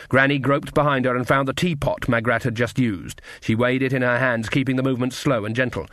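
plain reading voice

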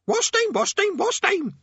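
very high, loud squawking; shrieking parrot. A narrator's reading. Parrot shrieking in Rincewind's ear, frantic squawk. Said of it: "shrieked the parrot".

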